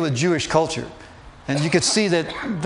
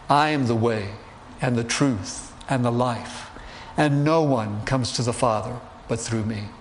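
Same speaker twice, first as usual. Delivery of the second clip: solemn; 5 semitones lower, 4 dB quieter than usual. Retold or imitated. Solemn quote of Jesus.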